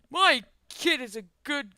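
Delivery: blustering dad voice; indignant father. Acted. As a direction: defend your child with hammed-up outrage. Angry father defends his child.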